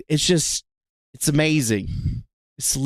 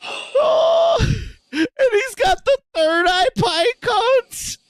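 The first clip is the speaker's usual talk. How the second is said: laughing; slower than usual. Real, not acted. Laughing at clip.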